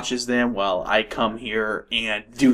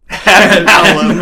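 laughing; 5 semitones higher, 10 dB louder, much slower than usual. Real, not acted. Laughing repeat of name.

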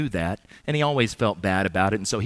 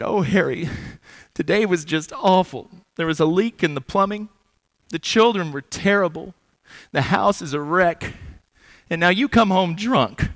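higher, louder, hammed-up wail of a frazzled wife; mock sobbing wife; 8 semitones higher, 4 dB louder than usual. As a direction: play the overwrought wife near tears, over the top. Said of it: "She's just sobbing".

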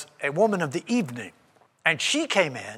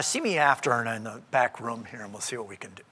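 lower, softer, secretive murmur; hushed private aside; 7 semitones lower, 6 dB quieter than usual. Retold or imitated. Jesus quietly tells the withered-hand man to meet privately, hushed. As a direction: keep it quiet, behind closed doors. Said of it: "oh, no, that's not what he says".

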